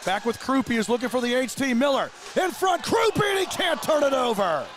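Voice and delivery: shouted rising hockey call; excited play-by-play. Real, not acted. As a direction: push the call up as the chance builds. Scramble in front called live.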